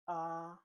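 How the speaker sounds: AH held high and level; first tone. Shown as a demonstration. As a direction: Mandarin first tone on AH, high, steady and loud. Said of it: "the first tone".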